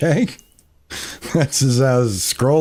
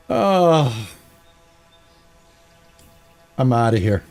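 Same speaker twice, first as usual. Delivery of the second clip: usual speaking voice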